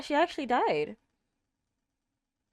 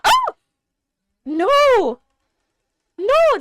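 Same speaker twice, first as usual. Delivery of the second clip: horrified no; 13 semitones higher, 5 dB louder, much slower than usual. Real, not acted. Fearing characters die.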